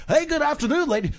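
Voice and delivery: high, loud hammed-up DJ patter; failed radio DJ. Captain greeting passengers on the intercom, failed radio DJ voice. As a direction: put on a cheesy DJ greeting. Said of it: "Like a failed radio D J".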